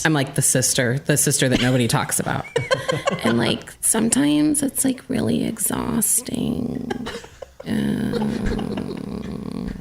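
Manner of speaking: creaky vocal fry, trailing off into a croak; Kardashian voice. Acted. Co-host impersonates a Kardashian sister on request, creaky vocal fry. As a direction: drag the words out in weary vocal fry. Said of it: "you do a pretty good Kardashian impersonation".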